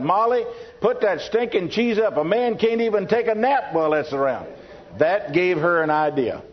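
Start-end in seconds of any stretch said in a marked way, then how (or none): 0.0-4.5 raised-pitch mock grumble to another room; annoyed calling out. Husband complaining to his wife from his nap, annoyed call. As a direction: call out higher, fed up with the smell. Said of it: "he called, called out through that".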